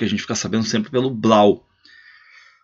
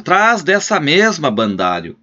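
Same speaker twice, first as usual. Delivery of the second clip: higher, slower, a touch of annoyance; mild scolding; 6 semitones higher, slower than usual. Acted. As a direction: snap the order at the servant. Scolding servant.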